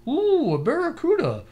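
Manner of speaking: high mock diver voice, laughing; squeaky excited diver exclaiming; chatty diver voice. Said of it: "and then they go".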